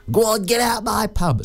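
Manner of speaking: hammed-up catchphrase impression; Barbara Windsor. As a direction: throw them out of the pub, high-pitched. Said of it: "Barbara Windsor, that was the one".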